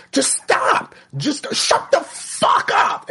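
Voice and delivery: high strained yell, half crying; mock breakdown scream at a daughter who won't stop; retold scream. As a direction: shriek it at the end of your rope. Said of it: "I'm screaming".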